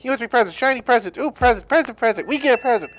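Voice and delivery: very high, eager Junimo chatter; excited little creature. Acted. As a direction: squeal for your present like tiny forest sprites.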